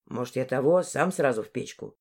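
low-pitched, haggling lad; young man's voice. Acted. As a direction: suggest it in a low male voice. Dmitry bargains with old woman.

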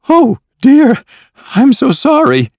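very high, loud, put-on dismay; flustered apology. A narrator's reading. Flustered hostess spills the milk.

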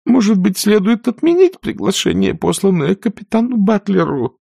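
put-on high, hesitant old aunt; timid hesitation. A narrator's reading. Aunt Pittypat timidly suggests cancelling the invitation. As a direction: venture the idea timidly. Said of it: "заикнулась было тётушка Питтипэт".

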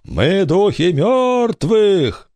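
high, very slow ghostly wail; voice from the grave. A narrator's reading. Girl posing as ghost to pirate, sepulchral drawn-out voice. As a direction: stretch it out like a spirit of the dead. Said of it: "загробным голосом заговорила с пиратом".

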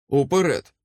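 short rallying cry; shouted command. A narrator's reading. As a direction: urge them forward. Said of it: "крикнув я".

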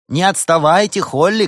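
high, loud playful shout; calling out. A narrator's reading. Young ladies shouting for their friend to keep up. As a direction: call to a straggler up ahead. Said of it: "кричали они".

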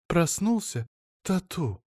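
a hint of a doting wife; wife's voice. Wife's voice, high.

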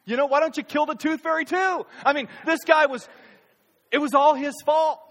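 high, loud, fast protest blaming the cop; indignant blame. Retold or imitated. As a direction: whine loudly that it was his fault. Preacher voices a blaming celebrity.

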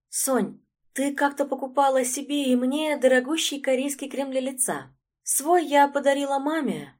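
lightly voiced girl, innocently asking; a sweet innocent question. A narrator's reading. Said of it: "невинно поинтересовалась". (невинный девичий голос)

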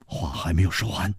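ordinary delivery

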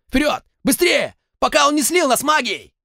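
high, loud shouted orders; commanding shout. A narrator's reading. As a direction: bark orders to charge forward. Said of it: "командный крик Слоуна".

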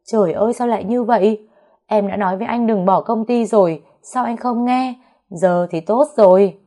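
wife wailing at her husband over the lost business, loud and high; wailing cry. A narrator's reading. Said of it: "ngã vật ra đất gào lên".

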